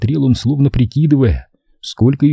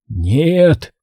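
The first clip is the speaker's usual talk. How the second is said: drawn, higher-pitched refusal; surprising force; 4 semitones higher than usual. A narrator's reading. Dying father stops his son from leaving, sudden forceful refusal. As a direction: forbid it with sudden effort. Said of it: "прозвучало с удивительной силой".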